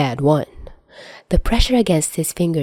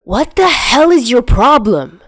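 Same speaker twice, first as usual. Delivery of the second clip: higher, louder furious yell; boy yelling at his friend in fury; angry shout; 7 semitones higher, 6 dB louder than usual. Acted. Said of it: "Chuya's anger".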